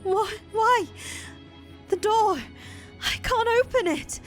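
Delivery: high, loud crying shouts; tearful screaming; sobbing panic. Acted. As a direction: cry out, pulling at a locked door. Giselle trapped behind the door.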